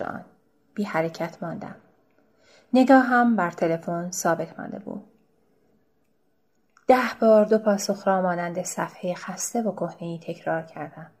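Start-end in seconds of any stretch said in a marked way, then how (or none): none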